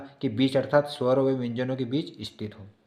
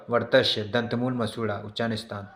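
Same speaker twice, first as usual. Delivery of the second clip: ordinary talk, nothing marked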